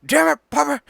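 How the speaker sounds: high, loud strangled cry; corrected Hank Hill line. Retold or imitated. Fixing the quote for his cohost, a high strangled yell. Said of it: "he doesn't say God".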